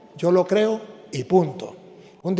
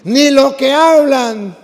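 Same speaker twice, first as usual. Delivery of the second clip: "Ni lo que hablan" loud, higher, slowed down; slow loud cue; 5 semitones higher, 7 dB louder, slower than usual. Shown as a demonstration. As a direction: lead the phrase slowly so they follow. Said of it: "Otra vez".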